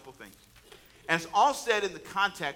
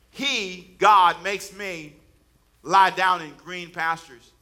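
each word of the verse drawn out and louder; slow emphatic quote; 7 dB louder, much slower than usual. Acted. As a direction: lay the verse down slowly and firmly.

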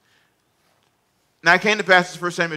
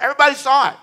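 ordinary delivery

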